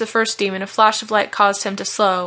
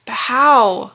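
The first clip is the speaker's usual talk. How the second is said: raised, helpless plea; pleading question, louder on the last word; 3 semitones higher, 5 dB louder than usual. A narrator's reading. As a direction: beg to be shown the way. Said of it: "he asked in a pleading voice".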